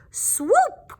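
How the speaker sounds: high, swooping squeak; bat mother. Acted. Bat mother calling her batties, high and swooping.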